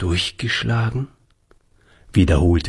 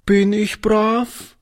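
put-on slow, higher, timid little girl's question; anxious little girl; 13 semitones higher, slower than usual. A narrator's reading. Little girl anxiously asks the old shopkeeper, slow and timid. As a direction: ask it like a frightened small child. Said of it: "fragte sie ängstlich".

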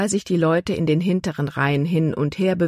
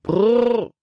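high, trilled call to halt horses; coachman's brr; 6 semitones higher than usual. A narrator's reading. Wagon boy halting his horses, trilled call. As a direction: roll out a raised-pitch "brrr". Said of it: "rief er".

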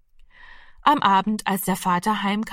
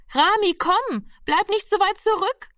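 calling out; 12 semitones higher than usual. A narrator's reading. Nurse to Rami lagging behind, calling out. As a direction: call the child back from afar, pitched high. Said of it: "rief die Schwester schon wieder".

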